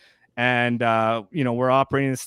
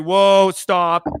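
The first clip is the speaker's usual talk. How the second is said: called-out stop; loud mock stop; mock halt call; 6 semitones higher, 7 dB louder than usual. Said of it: "they're just like".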